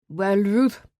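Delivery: a threatening man rumbles the name Ruth; growling man's voice. A narrator's reading. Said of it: "The growling voice rumbled directly beneath me".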